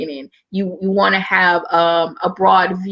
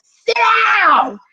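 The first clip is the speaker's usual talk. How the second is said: yelling at the class; 14 semitones higher than usual. Retold or imitated. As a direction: snap the class back into line. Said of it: "Like, I'm yelling".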